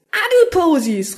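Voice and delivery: high, drawn-out cry of discovery; excited exclamation. A narrator's reading. Doctor has a sudden brainwave, calling out excitedly. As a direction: burst out with a slow, high flourish. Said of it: "rief er begeistert aus".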